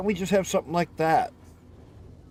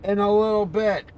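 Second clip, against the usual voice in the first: louder and higher, irritated; annoyed repeat; 6 semitones higher, 4 dB louder than usual. Real, not acted. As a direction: snap it back, fed up with the question.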